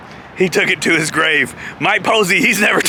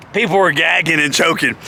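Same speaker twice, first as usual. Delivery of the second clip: usual speaking voice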